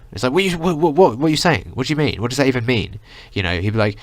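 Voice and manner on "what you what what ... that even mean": high-pitched, stammering bafflement; exasperated confusion. Retold or imitated. Listener to the mumbling guy, baffled and exasperated. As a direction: splutter in disbelief, pitched up.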